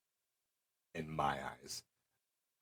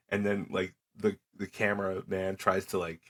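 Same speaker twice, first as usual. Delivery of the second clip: usual speaking voice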